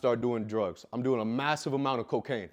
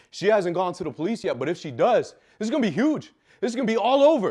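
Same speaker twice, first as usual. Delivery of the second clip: rising, louder angry warning; retold threat, pitch climbing as it builds; heated confrontation; 5 semitones higher, 6 dB louder than usual. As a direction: build from firm to raised-voice outrage. Acted confrontation with his stepdad.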